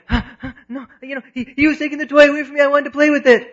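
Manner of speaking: imitating an upset kid, gasping then shouting; high, loud, rushed childish protest; worked-up child. Child called over after grabbing a toy, mock distraught wail. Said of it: "they're just in this state".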